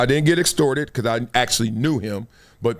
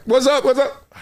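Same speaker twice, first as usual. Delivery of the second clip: high, hyped greeting over the shoulder; calling out greetings; 9 semitones higher than usual. Retold or imitated. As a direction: greet everyone while walking. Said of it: "And you're like".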